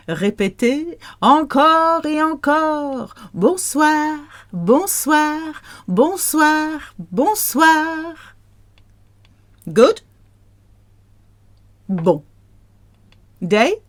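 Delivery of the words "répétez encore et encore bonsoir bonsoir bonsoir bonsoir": high, held French phrases; bright-pitched repetition, rising onto held notes; sing-song drill. Shown as a demonstration. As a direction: chant it high for learners to repeat.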